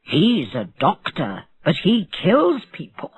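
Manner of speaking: plain reading voice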